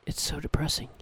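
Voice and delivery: soft wry whisper; whispered aside. Acted. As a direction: breathe it out as a dry aside. Old woman's wry aside.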